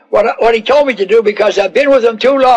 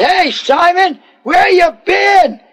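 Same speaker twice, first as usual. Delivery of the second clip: high, slow calling shout across the shore; hollering fisherman; 8 semitones higher, slower than usual. Acted. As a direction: call out to Simon from afar. One of them hollers.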